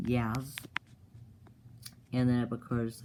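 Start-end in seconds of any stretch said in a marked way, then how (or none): none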